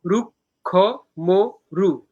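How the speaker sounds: "রুক্ষ মরু" broken into separate beats; syllable by syllable. Shown as a demonstration. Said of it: "এই চার মাত্রা একটা পর্ব".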